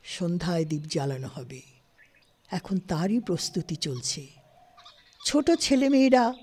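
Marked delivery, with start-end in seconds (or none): none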